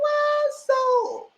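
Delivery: high, put-on "Well, so"; stunned reaction. Real, not acted. Speaker stunned by Keandre's words, high stunned reaction. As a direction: let the shock lift the voice.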